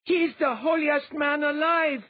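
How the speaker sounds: high, loud proclaiming voice; a disguised priest stirring the crowd, loud and high; declaring priest. A narrator's reading. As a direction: announce him to the crowd. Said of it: "a disguised grey robe priest declared".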